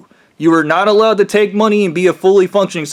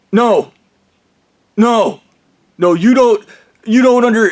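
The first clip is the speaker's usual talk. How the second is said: higher-pitched imitation, each refusal set apart; mock insistent refusal; 5 semitones higher, 3 dB louder than usual. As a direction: lightly mimic a stubborn objector.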